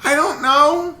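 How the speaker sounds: put-on high, small sad kid; a lonely little boy; sad child voice. Ghost child sad about dad.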